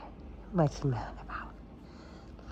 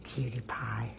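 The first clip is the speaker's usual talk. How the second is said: soft whispered pet names; 5 semitones lower, 12 dB quieter than usual. Real, not acted. Owner whispering pet names to her cat, whisper.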